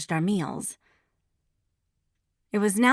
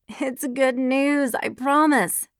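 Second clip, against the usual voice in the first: pleading; 6 semitones higher, slower than usual. A narrator's reading. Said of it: "she pleaded".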